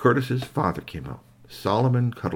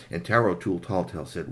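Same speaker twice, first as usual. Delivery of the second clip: usual speaking voice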